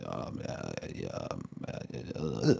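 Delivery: very soft mumbled ums and uhs, imitating him; muttering to himself. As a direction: mumble wordlessly under your breath, mimicking someone. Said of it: "talking to himself a wee bit".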